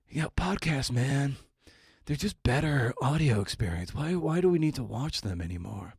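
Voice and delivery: imitating a relaxed guy praising audio; laid-back podcast fan. Host mimics future fans praising podcasts, mellow dude voice. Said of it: "People are gonna be like".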